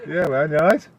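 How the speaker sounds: loud, high greeting across the room; calling out. Real, not acted. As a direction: call out cheerily to a mate. Greeting a viewer loudly.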